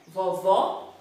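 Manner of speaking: VOVÓ with open "aw" vowels; low, open-O "vovó". Shown as a demonstration. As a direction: model "vovó" with open O sounds. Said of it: "o som da palavra vovó é aberto".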